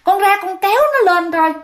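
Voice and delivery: mild hint of a mother's urgent call. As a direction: sketch the mother urging her son out. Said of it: "Mẹ mới kêu bạn ra".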